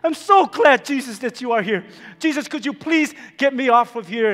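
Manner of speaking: loud, high excited pleading; gushing relief. Acted. Hanging man thanks Jesus for coming, loud relieved gushing. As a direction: pour out relief, then plead.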